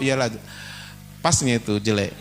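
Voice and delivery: calm humble answer. Shown as a demonstration. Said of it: "kan gitu kan".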